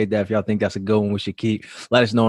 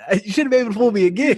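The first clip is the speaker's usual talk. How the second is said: helpless high-pitched laughter through the words; real laughing; 9 semitones higher than usual. Real, not acted. Friends cracking up over a botched saying, laughing hard. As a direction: barely get the words out for laughing.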